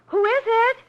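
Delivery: high, loud call toward the door. Acted. Calls out to knock at door.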